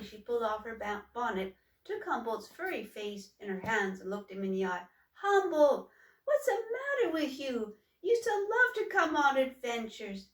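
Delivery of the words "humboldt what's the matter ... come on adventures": much higher and louder, pressing him; worried sister. Acted. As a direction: shake him out of his gloom. Sister confronts gloomy brother.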